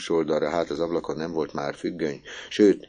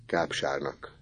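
hushed, much softer secret answer; whispered; 2 semitones lower, 8 dB quieter, slower than usual. Acted. Misi whispers the word to Orci, soft and hushed. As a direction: breathe it to your friend. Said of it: "súgta neki Misi".

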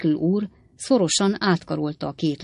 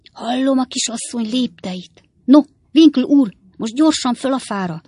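higher, louder call to hurry; urgent prompting; 6 semitones higher, 6 dB louder than usual. A narrator's reading. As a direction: spur him on, pitched up and pressing. Sam urges Winkle up the tree. (izgatott, sürgető biztatás)

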